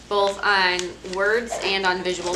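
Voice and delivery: ordinary talk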